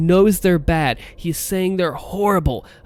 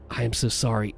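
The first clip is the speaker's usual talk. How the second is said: low, soft breathy voice; airy, breathy apology; 5 semitones lower, 6 dB quieter than usual. Shown as a demonstration. Voice actor recapping the character admitting fault, airy and breathy. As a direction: let the apology out on air. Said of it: "Airy, breathiness".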